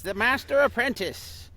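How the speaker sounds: high-pitched master-and-apprentice title; demo character voice. Shown as a demonstration. Guest quoting his voice demo, high character voice. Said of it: "that's part of my demo".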